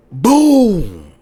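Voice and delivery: loud, high sound effect; storyteller imitating a flashbang, loud; boom. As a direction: slam it out like an explosion. Door blown in, story climax.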